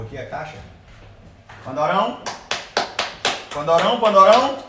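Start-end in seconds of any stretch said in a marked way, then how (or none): 1.6-4.6 loud, high call across the room. Real, not acted. Calling the dog loudly.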